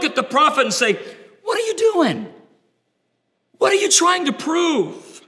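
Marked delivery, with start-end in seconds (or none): 1.4-5.2 high, shouted scoffing disbelief; mocking crowd. The world scoffing at Elijah, high mocking shout. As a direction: jeer at him like a scornful onlooker.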